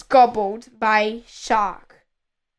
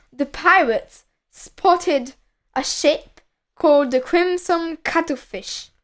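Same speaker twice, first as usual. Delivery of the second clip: usual speaking voice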